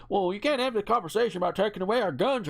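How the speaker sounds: imitating a Republican. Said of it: "or however he talks".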